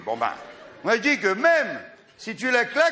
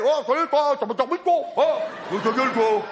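hammed-up family greeting, higher; mock family onomatopoeia; 5 semitones higher than usual. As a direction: play a silly Sunday morning family. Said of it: "Parlons-nous en onomatopée".

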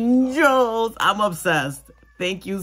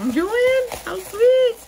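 mock-sweet squeal, pitched way up; sweet high gushing voice; 5 dB louder, slower than usual. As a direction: gush over the gift like a delighted friend. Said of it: "'cause I was like".

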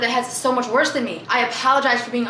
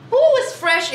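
excited; 11 semitones higher, 4 dB louder, slower than usual. Real, not acted. Praising perfume smell.

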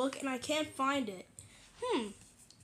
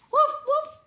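high, loud puppy barks; dog barking; 13 semitones higher, 7 dB louder than usual. Acted. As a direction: yap like an excited puppy.

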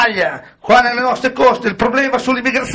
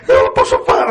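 hammed-up high sobbing plea; mock crying, higher; mock sob; 11 semitones higher than usual. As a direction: fake a tearful breakdown.

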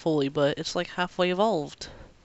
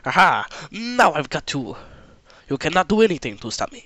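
gloating villain; 5 dB louder than usual. Retold or imitated. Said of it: "they were acting like".

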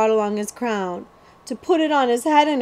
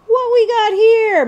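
higher, louder, slow greedy question; mild mutter; 13 semitones higher, 8 dB louder than usual. Acted. The thin outlaw opens the prince's basket, greedy and slow. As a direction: peek in greedily. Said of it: "muttered the bone-thin man".

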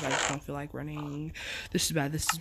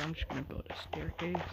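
quick unvoiced whisper; 9 dB quieter, faster than usual. Real, not acted. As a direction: whisper it to yourself. Whispered aside.

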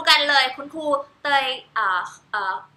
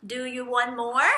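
very slow "Do you want more"; slow gentle question to baby; much slower than usual. Shown as a demonstration. Mother asks her baby if it wants more milk, very slow. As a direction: ask the baby slowly and kindly. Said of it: "ต้องการเพิ่มอีกไหม".

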